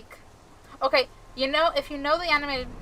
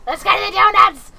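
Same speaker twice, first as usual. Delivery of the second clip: loud, higher excited yell; hammed-up battle cry for donuts; gleeful Hamble outburst; 8 semitones higher, 14 dB louder than usual. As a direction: burst out in manic glee. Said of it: "And Hamble's just like".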